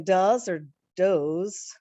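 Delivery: "does" said duz then dohz, slowly; slow contrast of "does" duz and dohz; two pronunciations. Shown as a demonstration. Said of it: "if it's a plural of the animal".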